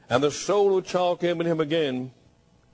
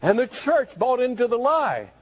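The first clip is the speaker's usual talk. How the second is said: ordinary talk, nothing marked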